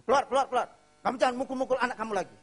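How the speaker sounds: loud, high, rapid scolding; angry grandfather. Retold or imitated. Grandfather defending the boy from his father, angry shout. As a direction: order him out sharply.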